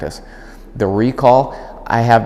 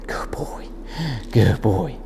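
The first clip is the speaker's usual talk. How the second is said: soft whispered praise; 7 dB quieter, slower than usual. Real, not acted. Trainer whispering praise to the dog, hushed. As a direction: breathe the praise softly.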